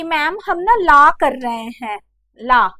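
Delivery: a student's wrong la for लॉ; mimicked wrong pronunciation. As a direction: imitate a student saying लॉ as la. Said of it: "बच्चे उसको कह देते हैं".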